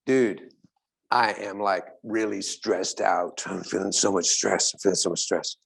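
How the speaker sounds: low, slow, weary complaint; stressed out. Retold or imitated. A friend complaining about his stress, low and weary. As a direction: sigh out the stress.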